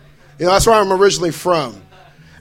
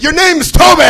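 raised, loud barked greeting; old-timey stranger yelling; 8 semitones higher, 5 dB louder than usual. Retold or imitated. Comic spotting a time traveler, shouting. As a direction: bark it like a startled old-timer. Stand-up acts out slave-era stranger.

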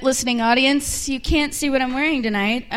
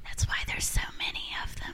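whisper; 10 dB quieter, faster than usual. Acted. Whispered aside.

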